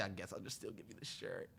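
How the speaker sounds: low, soft grudging offer; lightly voiced recruiter giving in; grudging Marine. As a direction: hand it over reluctantly, quietly. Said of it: "he said".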